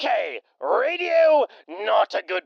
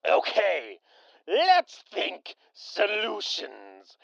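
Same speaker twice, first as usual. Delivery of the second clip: ordinary talk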